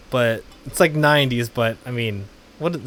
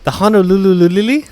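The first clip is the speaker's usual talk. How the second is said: baffled; 6 semitones higher, 6 dB louder, faster than usual. Retold or imitated. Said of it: "'Cause I was like".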